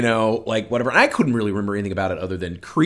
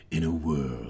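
horror trailer voice; 5 semitones lower, 5 dB quieter, slower than usual. Pretend. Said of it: "in this voice".

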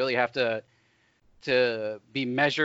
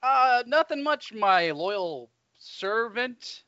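mock royal; 7 semitones higher, much slower than usual. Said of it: "That feels weird to say".

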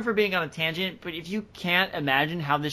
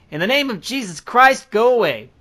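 loud, higher call to drive it away; commanding shout; 7 semitones higher, 7 dB louder than usual. Retold or imitated. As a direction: shout it out, invoking Jesus. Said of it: "says something to the effect of".

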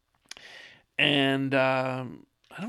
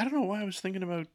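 usual speaking voice

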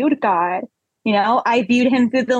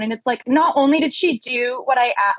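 ordinary talk, nothing marked

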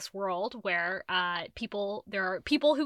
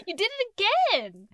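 playful accusation; 10 semitones higher, 4 dB louder than usual. Real, not acted. Teasing co-host who nearly spat.

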